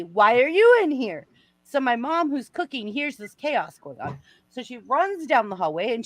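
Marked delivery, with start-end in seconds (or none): 0.0-1.3 raised, higher outraged voice; mock a dad yelling in outrage; retold louder yelling. Daughter imitating dad yelling at her in shower, raised voice. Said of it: "he was yelling louder at me".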